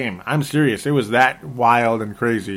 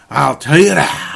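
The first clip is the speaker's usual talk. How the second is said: laughing shout; 3 semitones higher, 6 dB louder than usual. Acted.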